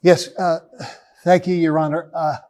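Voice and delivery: short of breath, nervous; a little short of breath. Real, not acted. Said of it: "I get a little short of breath".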